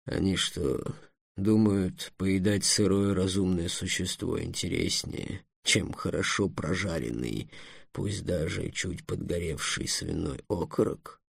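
low, soft grumbling dragon; bitter dragon. Acted. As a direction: grumble quietly at human foolishness. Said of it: "горько сказал Эллен". (тихое горькое ворчание дракона)

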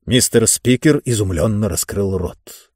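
ordinary delivery

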